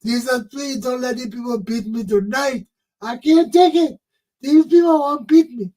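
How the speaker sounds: put-on child praying not to be beaten; begging prayer. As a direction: beg Jesus for mercy like a scared child. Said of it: "I like to pray to beg Jesus".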